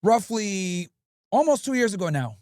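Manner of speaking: normal talking voice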